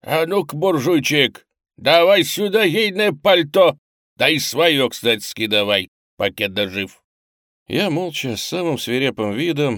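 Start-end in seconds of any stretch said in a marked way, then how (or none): 0.0-7.0 threatening. A narrator's reading. Robber demanding coats from a lone man, threatening. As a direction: order him loudly, with menace. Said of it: "он угрожающе добавил".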